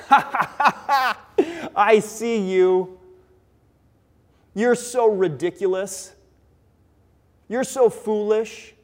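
taunting laugh into slow, drawn-out jeers; laugh, then mock them slowly and loudly; mocking laughter. Enemies jeer at David in his trouble, mocking and laughing. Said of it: "Those who are mocking and laughing".

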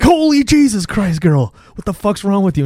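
high, loud mock shock; startled outburst. Man finding a girl at his bedside, comically startled. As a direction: jump out of your skin, pitch shooting up.

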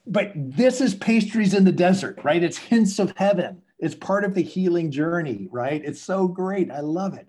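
high-pitched, gushing encouragement; excited enthusiasm. Real, not acted. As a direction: celebrate it with real delight. Exults over guest's healing habit.

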